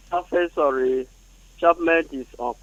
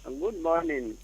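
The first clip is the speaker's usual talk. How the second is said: too quiet; 2 semitones lower, 12 dB quieter, slower than usual. Real, not acted. Caller greeting the radio host, too quiet. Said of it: "Could you speak up?".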